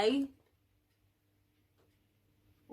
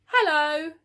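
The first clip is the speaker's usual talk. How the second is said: Norman's hello; 7 semitones higher, 10 dB louder than usual. Acted. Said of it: "said Norman".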